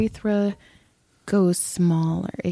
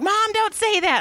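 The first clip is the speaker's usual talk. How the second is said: put-on whiny kid; 13 semitones higher than usual. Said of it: "And he's like".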